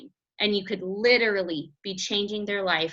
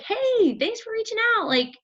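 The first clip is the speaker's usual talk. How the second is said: much higher, bright greeting; friendly opener; 7 semitones higher than usual. Shown as a demonstration. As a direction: sound welcoming and curious.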